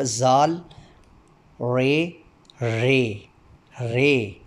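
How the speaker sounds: each Urdu letter name sounded out slowly and distinctly. Shown as a demonstration. Teacher drilling the Urdu alphabet for demo candidates, slow and distinct. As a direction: recite the alphabet, one clear sound at a time. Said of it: "हर हरफ की आवाज़ अदा करते हुए".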